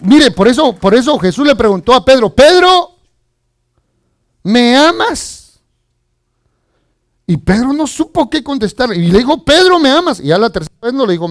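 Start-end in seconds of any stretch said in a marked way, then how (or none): 2.3-5.6 high, loud call, then a slow plea; calling out a question. Retold or imitated. Jesus asks Pedro about his love, calling out high and slow. 9.5-10.2 calling out a question. Retold or imitated. Acts Jesus asking Peter again.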